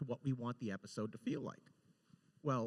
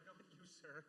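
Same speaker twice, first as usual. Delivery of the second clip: much softer embarrassed apology; apologizing to a guard below, much softer; sheepish apology; 4 semitones higher, 20 dB quieter than usual. Retold or imitated. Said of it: "And I was like".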